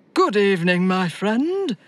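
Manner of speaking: lightly voiced jolly Santa greeting; friendly Santa welcome; smiling Santa. A narrator's reading. Santa greeting the tired elf, smiling. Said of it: "Santa said with a smile".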